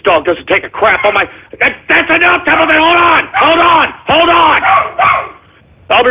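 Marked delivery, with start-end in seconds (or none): 1.6-4.6 yelling at the dog. Acted. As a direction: bark orders at a frantic dog. Shouting the dog down.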